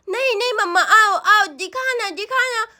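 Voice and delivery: put-on childish, pleading voice; insistent little boy. Boy begs mamma to come and see, childishly insistent. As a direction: urge her along like a small child.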